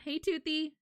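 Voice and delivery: ordinary talk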